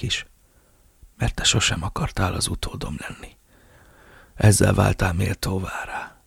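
faint, breathy whispered confession; an old boss confessing on his deathbed; dying old man. Acted. Dying Ramirez speaks, faint whisper. (haldokló öregember suttogása)

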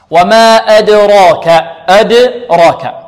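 أدراك slowly, the dal with a clear bounce; slow bouncing dal. Shown as a demonstration. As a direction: stretch وما أدراك, echoing the dal. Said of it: "أدراك كلمة كذلك".

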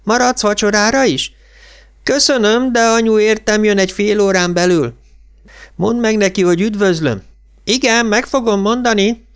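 high-pitched, carrying exchange; calling between rooms. A narrator's reading. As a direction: call it across the house. Mother and boy call between rooms.

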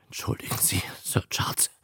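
almost whispered mumble; muttered. A narrator's reading. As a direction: excuse yourself under your breath. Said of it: "brummte er".

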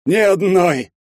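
high, loud thunderous retort; booming. A narrator's reading. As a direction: roar out the punchline. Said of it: "прогрохотал Щедрин".

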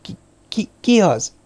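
stuttered, whispered start rising into a high question; frightened whisper. A narrator's reading. Terrified girl whispering toward the door, stammering. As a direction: falter on the first word, barely voiced. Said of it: "az ajtó felé suttogta".

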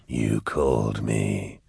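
low, slow demon purr; deep voice, every word drawn out; Cherubael. A narrator's reading. Cherubael answers the man who summoned him, slow and eerie.

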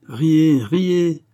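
grogne, haut et fort; râle en haussant nettement la voix; grommellement de duègne. A narrator's reading. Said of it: "grommela la duègne". (duègne qui rouspète)

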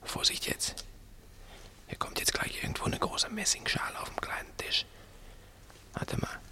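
tonlos geflüstert; flüstern. Acted. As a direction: ganz leise, kaum hörbar. Schleichen zur Tür hinauf. (flüsternd kurz vor der Tür)

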